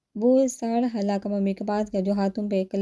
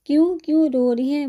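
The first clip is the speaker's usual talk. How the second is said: higher, louder worried question; mildly alarmed; 6 semitones higher, 5 dB louder than usual. Acted. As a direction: ask with a jolt of concern. Alarmed on hearing she cries.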